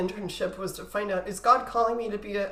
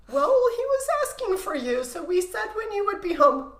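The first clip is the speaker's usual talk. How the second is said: much higher, laughing parent imitation; mock parent voice; 9 semitones higher than usual. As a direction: pitch it way up, teasing like a parent. Parents voiced in retold story.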